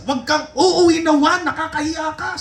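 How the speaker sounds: mock-scold the son for his score; imitating the annoyed father.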